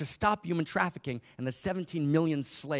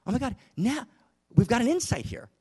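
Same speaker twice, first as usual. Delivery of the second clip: a much higher, louder burst of discovery; thrilled, higher and louder, over a new insight; excited; 10 semitones higher, 6 dB louder than usual. Retold or imitated. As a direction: gasp it out like a sudden insight. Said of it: "when we get excited".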